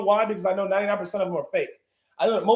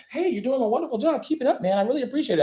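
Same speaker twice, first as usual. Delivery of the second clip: smooth flatterer; 4 semitones higher, faster than usual. Retold or imitated. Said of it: "I used to do it all the time".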